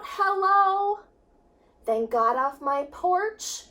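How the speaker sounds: ordinary delivery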